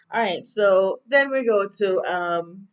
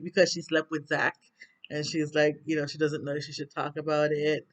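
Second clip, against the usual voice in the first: normal talking voice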